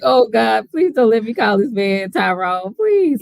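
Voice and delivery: playful imitation of a desperate plea; mock pleading. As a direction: beg heaven, half-joking. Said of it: "I was like".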